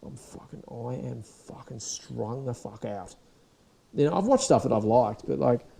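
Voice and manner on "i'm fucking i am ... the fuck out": drained mutter. Retold or imitated. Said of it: "you're like".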